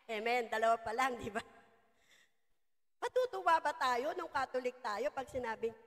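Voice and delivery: usual speaking voice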